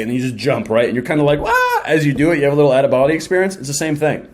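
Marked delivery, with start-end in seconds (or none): 1.4-1.8 jumping yelp. Retold or imitated. Mock cliff-jump yelp.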